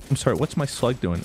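usual speaking voice

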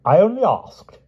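high, loud, lofty protest; with dignity. A narrator's reading. Pellinore defending himself to Grumore, lofty and dignified. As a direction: draw himself up and say it proudly. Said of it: "said the king with dignity".

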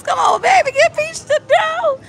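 high, shouted plea rising sharply; excited shout. Real, not acted. Gambler urging the slot to pay, high excited shout. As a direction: yell at the machine to pay up. Near miss, cheering the slot.

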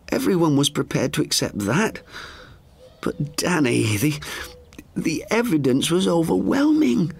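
put-on high elderly woman's voice, alarmed; high, startled sister's voice; Frances, frightened. A narrator's reading. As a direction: gasp it out as the frightened old sister.

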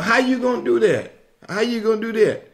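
high-pitched incredulous challenge; arguing doubter. Acted. Listener arguing with someone promising a million dollars, high and challenging. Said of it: "you sitting there argue with them".

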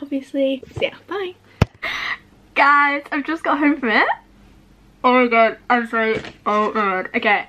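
muffled through hand; speaking behind held card. Real, not acted. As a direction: mouth covered while talking.

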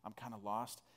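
soft, low retold question to a neighbour; confiding aside. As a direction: lean over and ask it under your breath. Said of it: "lean over to them and just say".

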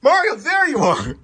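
high loud joyful greeting; excited greeting. Acted. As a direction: greet him, thrilled.

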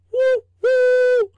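high, loud "woo woo" train whistle; long, high, loud whistle toot. A narrator's reading. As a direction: hoot like a steam locomotive whistle. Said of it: "of Charlie's whistle".

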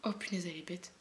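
fond whispered grumble; whispered aside. Real, not acted. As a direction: whisper it to the camera. Aside about sister.

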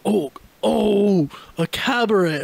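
mock knowing exclamation; sudden realization. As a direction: play up the penny dropping. Acts out sudden understanding.

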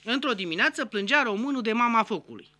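usual speaking voice

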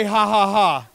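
loud, spelled-out "ha, ha, ha"; mock written-out laugh. Shown as a demonstration. Preacher quoting what his notes don't say, loud mock laugh. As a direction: read the laugh out loud as words. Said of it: "it does not say".

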